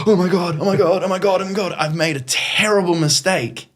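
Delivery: panicked. Retold or imitated. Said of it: "and I went".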